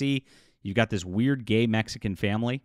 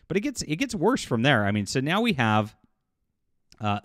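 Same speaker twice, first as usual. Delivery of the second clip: ordinary delivery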